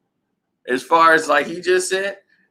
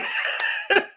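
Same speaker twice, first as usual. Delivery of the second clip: a high, airy, unvoiced laugh; breathy high laugh; 5 dB quieter than usual. Real, not acted. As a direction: let the laugh out on air. Laughs at the story.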